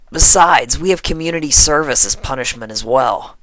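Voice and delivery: loud whiny lament. A narrator's reading. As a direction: moan about the punishment. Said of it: "lamented Rainbow".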